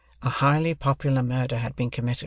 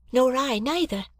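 put-on lightly raised girl's voice; young woman voice; 12 semitones higher than usual. A narrator's reading. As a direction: give Biddy a higher, gentle tone. Biddy voiced in dialogue.

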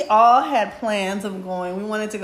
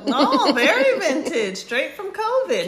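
laughing; 8 semitones higher, 4 dB louder than usual. Real, not acted. Joking about wine year.

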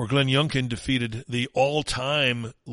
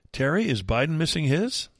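ordinary delivery

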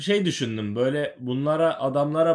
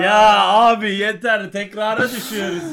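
exasperated; 7 semitones higher, 6 dB louder than usual. Real, not acted. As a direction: groan it out, sick of this. Fed up with repeated theory.

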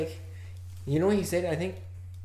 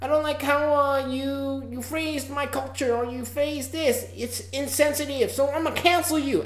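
higher-pitched mock outrage, whiny and dismissive; mocking the cancel crowd; 7 semitones higher, 4 dB quieter than usual. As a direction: parody an offended internet mob, looking down on them. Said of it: "they just go".

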